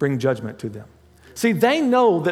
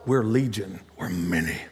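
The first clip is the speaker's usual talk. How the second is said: demon voice; 12 semitones lower, 5 dB quieter than usual. Acted. The demon's boast voiced again.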